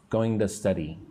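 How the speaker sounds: to run into going as "going-duh"; going to pronounced "going-duh"; reduced "to". Shown as a demonstration. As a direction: say to as a quick duh before study. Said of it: "like duh".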